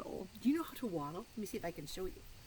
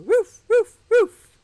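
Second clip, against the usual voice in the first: much higher and louder barks; dog barking; 13 semitones higher, 21 dB louder, slower than usual. Pretend. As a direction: bark like an excited little dog. Barks inside the song.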